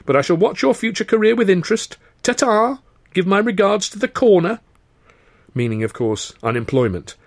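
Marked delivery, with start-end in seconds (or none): 0.1-4.6 high, sing-song taunt; trilling mockery. A narrator's reading. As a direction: keep trilling the sneer up high. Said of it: "he trilled".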